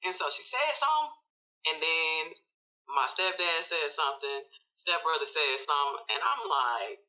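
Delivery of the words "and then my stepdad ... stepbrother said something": normal talking voice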